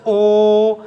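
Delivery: ओ held long and slow; stretched call. Shown as a demonstration. Teacher showing how to stretch the call, long ओ. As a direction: drag the ओ out. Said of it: "ऐसे खींचो ना".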